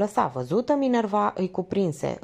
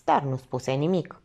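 ordinary delivery

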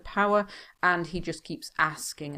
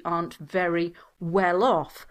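ordinary talk

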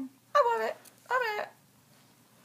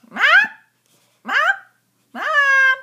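yelling across the room; 3 semitones higher, 6 dB louder than usual. Pretend. Calling for mom.

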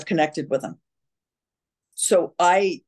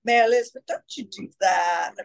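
Southern accent; 2 semitones higher than usual. Shown as a demonstration. Actress showing her old TV mother role, Southern accent. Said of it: "I would talk like this, my Southern accent".

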